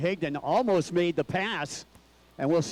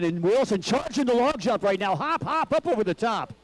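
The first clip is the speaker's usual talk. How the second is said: excited play-by-play; 5 semitones higher than usual. Real, not acted. Live call of log jump.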